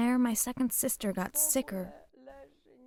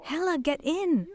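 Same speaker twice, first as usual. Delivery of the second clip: high, restrained urgent shout; retold scream; 7 semitones higher, 3 dB louder than usual. Father to daughter as the train moves, retold scream. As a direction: call out the order, pitch jumping up. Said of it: "and Daddy screamed".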